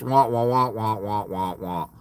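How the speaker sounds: low, soft wordless drone sinking away; mock droning wah-wah. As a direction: imitate boring speech fading out. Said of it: "you sound like this".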